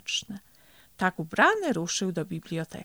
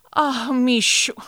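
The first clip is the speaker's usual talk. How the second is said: loud, slow, drawn-out exclamation; emphatic address to a friend; exclaiming; 5 semitones higher, 8 dB louder than usual. A narrator's reading. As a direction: stretch it out loudly with raised pitch. Turtle exclaims on finding lost book.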